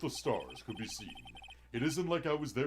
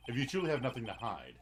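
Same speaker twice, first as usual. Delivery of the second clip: ordinary talk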